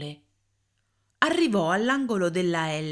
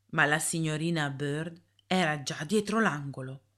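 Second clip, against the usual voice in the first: ordinary delivery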